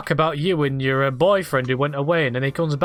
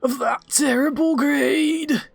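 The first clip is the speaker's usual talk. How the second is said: high, slow, anguished wail of a dying queen; a ruined queen sobbing out her regret, much higher; dying queen crying out; 9 semitones higher, slower than usual. Acted. Dying queen to her daughter, high anguished crying voice.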